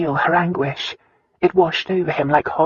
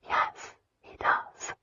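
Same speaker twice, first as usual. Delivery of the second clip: slow, barely audible whisper, much softer; 11 dB quieter, much slower than usual. A narrator's reading. Christina admitting to Lyon her father hates whites, whispered. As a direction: breathe the admission out slowly. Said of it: "Christina whispered".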